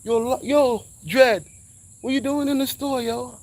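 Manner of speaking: high hailing voice of the mall dudes; calling out. Retold or imitated. Dudes in the store hailing him, calling out high. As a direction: imitate the guys shouting his nickname.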